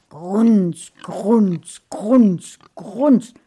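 loud snorting pig grunts from the mother. A narrator's reading. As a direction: grunt like a wallowing sow. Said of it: "kam es von der Mutter". (lautes Schweinegrunzen)

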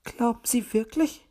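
mildly doubtful bird lady; unsure. A narrator's reading. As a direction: ask for reassurance, a touch unsure. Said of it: "hakte Frau Elster unsicher nach".

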